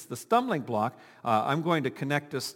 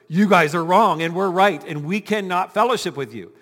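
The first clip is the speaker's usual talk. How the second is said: louder, indignant declaration of rightness; mock denomination split; 3 semitones higher, 8 dB louder than usual. Founders breaking away from the other church, hammed-up loud indignation. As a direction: shout down the other camp.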